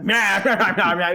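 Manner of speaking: mocking babble. Men brushing off orders to stop, mock jeering babble. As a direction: jeer back in loud wordless gibberish. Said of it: "there's like".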